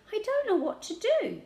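very high, helpless plea; desperate. A narrator's reading. Child at a loss beside grandad, desperate. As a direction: cry out helplessly for guidance. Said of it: "I said desperately".

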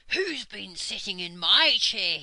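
loud, growly bear voice; angry Father Bear. A narrator's reading. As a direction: growl it out indignantly as a big bear. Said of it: "gave an angry growl".

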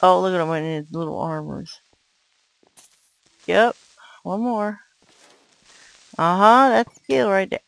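ordinary talk

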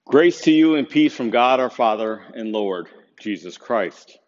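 ordinary talk

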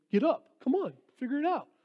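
hammed-up mean dad yelling at a toddler; harsh mock scolding. Dad mock-scolding his son who fell, impatient. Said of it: "you don't go, 'Pfft".

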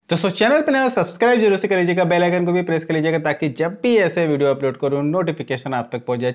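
high, bright-eyed YouTuber pitch; eager subscribe plea. Real, not acted. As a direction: pitch way up to hook new viewers. Channel subscribe request, YouTuber plea. (ऊँची आवाज़ में उत्साह से subscribe की appeal)

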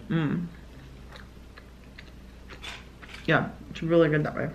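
muffled by chewing food; chewing while talking. Real, not acted. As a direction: mouth partly full. Seen 0: Bites chip at -2; lips closed, chewing through the labelled words.

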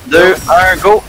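loud countdown shout; countdown call. Real, not acted. Countdown to jump.

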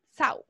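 "Sau" con la L final dicha como u. Shown as a demonstration. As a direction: di "Sau" terminando en u, sin L. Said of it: "la L final de palabra". ("Sau" pronunciado con u final)

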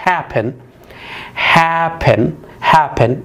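happen with HAP stressed and pen quick; stress on first syllable. Shown as a demonstration. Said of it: "الستريس على المقطع الأول".

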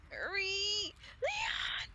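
panicked shout. Real, not acted. Yelling at character in danger.

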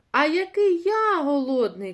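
loud, high mouse voice; high, exaggerated storybook cry of hunger; hungry exclamation. A narrator's reading. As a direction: outdo your friend's hunger, stressed loudly. Second mouse outdoes the first's hunger.

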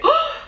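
sharp, high gasped "oh"; startled gasp. Retold or imitated. Reviewer re-enacting a reaction on the plane, startled gasp. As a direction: gasp out loud, pitch leaping up.

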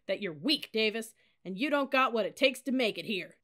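loud, scornful verdict; berating taunt. A narrator's reading. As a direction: tear the cadet down at full voice. Officer berating her.

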